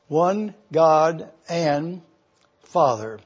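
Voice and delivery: One God and Father, very slow, pausing before Father; stress on the missing article. Shown as a demonstration. Teacher showing the class the gap before "Father" with slow stress. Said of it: "there is no word before Father".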